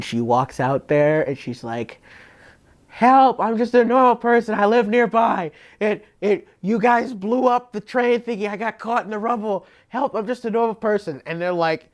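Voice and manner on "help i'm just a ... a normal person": high, pleading mock cry for help; fake helpless bystander. Swindler tricks the executioners into helping, high fake pleading. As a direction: play a phony innocent begging to be rescued.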